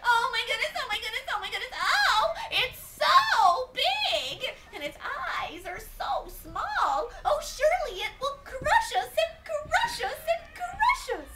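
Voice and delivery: high, loud, panicked rabbit wail; terrified rabbit. Acted. Rabbit seeing the huge elephant, hammed-up high panic. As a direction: panic as a tiny rabbit, stretching the big words. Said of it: "none was more afraid than Rabbit".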